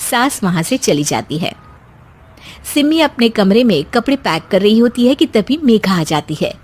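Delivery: plain reading voice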